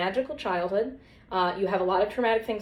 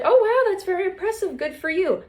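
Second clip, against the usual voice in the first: much higher, gushing praise; high mock-gushing psychiatrist; impressed doctor; 10 semitones higher than usual.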